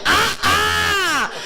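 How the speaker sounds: high shouted crowd jeer; mock crowd heckle. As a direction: bark it like a rowdy crowd. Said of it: "aggressive Harlem Nights who are like".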